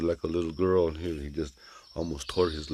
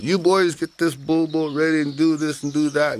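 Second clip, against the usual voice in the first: put-on high, loud old woman giving orders; bossy old lady; 10 semitones higher, 6 dB louder than usual. A narrator's reading. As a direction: order the boys about in a raised, high-pitched voice. Old lady bossing the boys.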